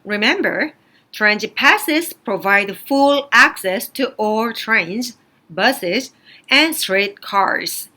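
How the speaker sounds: slow, careful announcement reading. Shown as a demonstration. Teacher reading a transit notice aloud, slow and measured. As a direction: read it out like a station notice.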